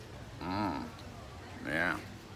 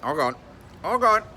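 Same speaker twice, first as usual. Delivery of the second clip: Jim Carrey impression; 8 semitones higher than usual. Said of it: "This is my Jim Carrey imitation".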